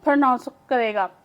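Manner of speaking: ordinary talk, nothing marked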